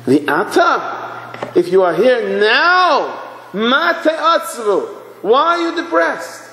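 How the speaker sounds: slow build to a high preaching cry; fervent, high-pitched challenge; impassioned exhortation. Acted. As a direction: stretch each phrase, climbing to a ringing appeal. Same question repeated, building to climax.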